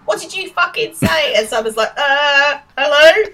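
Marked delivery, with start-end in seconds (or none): 0.1-1.3 high-pitched mock Estuary challenge; estuary accent. Girl rounds on Simon, in a high Estuary accent. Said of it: "estuary accent says". 2.0-3.2 high, loud, mock-awkward hello; hesitant greeting. Simon answers the abusive girl, acted-out hesitant greeting.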